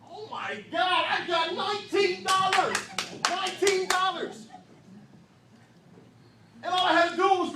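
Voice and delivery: thrilled yell. Retold or imitated. Kid shouting with joy over the nineteen dollars. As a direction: scream it like you just hit the jackpot.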